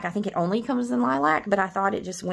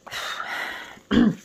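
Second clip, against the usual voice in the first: a long, shaky-free breathy sigh before speaking; emotional sigh. Real, not acted. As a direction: let out a heavy, emotional sigh. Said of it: "I'm getting a little emotional".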